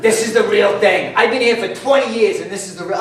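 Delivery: can barely get it out for laughing; mock-impressed customer cracking up.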